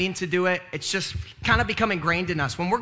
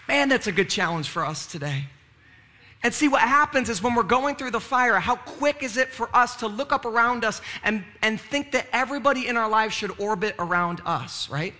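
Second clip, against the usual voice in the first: usual speaking voice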